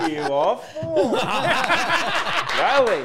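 high burst of laughter; slow, laughing words. Real, not acted.

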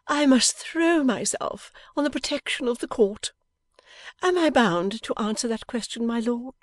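high, loud, theatrical appeal to a judge. A narrator's reading. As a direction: plead gaily as if playing at court. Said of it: "still gaily".

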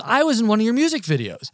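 high-pitched, excited fan impersonation; retold freak-out. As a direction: blurt it like an overexcited fan. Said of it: "absolutely freaks out".

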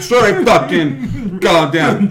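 high, slow, laughing through it; giggling while playing the angry boss; laughing mock boss. Boss apologizing mid-rant, laughing.

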